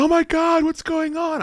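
high startled wife; panicked wife. Retold or imitated. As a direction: imitate the frightened wife.

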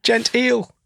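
mock posh. Mocking the posh word.

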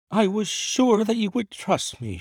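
high, relieved wife's voice; wife crying out in relief. A narrator's reading. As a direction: cry out relief in a high woman's voice. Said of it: "she cried".

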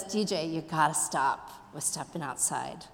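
low, soft teacher's reply; acted teacher conceding in a hushed, low voice; teacher relenting. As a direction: give in quietly, pitched well down. Teacher concedes, repeats her warning, retold.

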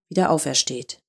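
ordinary delivery, nothing marked

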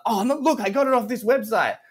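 high-pitched protesting student; defensive student. Retold or imitated. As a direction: imitate a student making excuses, voice way up. Voices the student defending himself.

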